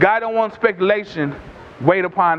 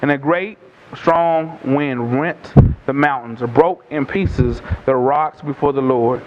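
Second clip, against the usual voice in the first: scripture reading; 7 semitones lower than usual. A narrator's reading. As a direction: read the passage solemnly, pitched well down. Reads scripture to congregation.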